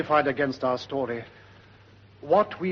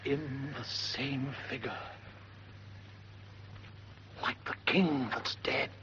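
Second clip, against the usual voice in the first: awed hush; 11 dB quieter than usual. Acted. Guard describing the ghost in a hushed voice. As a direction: breathe it out in dread.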